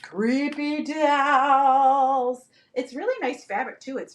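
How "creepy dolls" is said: drawn-out, swelling ghostly call; spooky wail. Pretend. Seller spooks viewers with doll leggings, drawn-out wail. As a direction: stretch it out like a haunted-house ghost. Spooky show-off after a boo.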